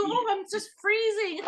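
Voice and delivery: high, laughing imitation of sister; high-pitched mock shivering complaint; mock complaining. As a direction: squeal it through giggles, quoting your freezing sister. Retells sister shivering, breaking into laughter.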